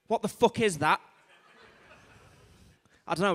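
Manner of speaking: ordinary delivery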